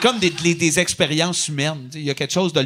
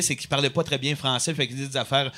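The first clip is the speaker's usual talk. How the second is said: usual speaking voice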